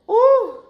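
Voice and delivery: high, loud laughing ooh; a big relieved whoop. Real, not acted. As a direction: let the fear out in a laugh. Relief after scary call.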